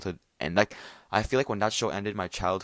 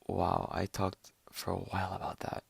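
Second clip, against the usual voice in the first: usual speaking voice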